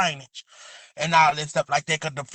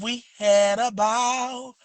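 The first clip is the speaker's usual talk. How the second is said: slow, savoured catchphrase; drawn-out catchphrase; 3 semitones higher, much slower than usual. Shown as a demonstration. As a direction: stretch it out like a signature line. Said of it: "You gotta say it just like that".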